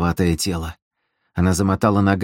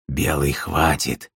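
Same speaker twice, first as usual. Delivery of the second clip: low, slow, weak wheeze; subdued, husky protest; hoarse croak; 5 semitones lower, 4 dB quieter, slower than usual. A narrator's reading. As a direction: croak a plea from the corner. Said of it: "просипел из угла Вадим".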